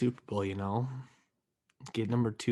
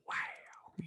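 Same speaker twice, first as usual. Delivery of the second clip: breathless whispered wow; hushed, stunned wow; awed whisper; 14 dB quieter than usual. Retold or imitated. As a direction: whisper it in disbelief. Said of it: "you're just like".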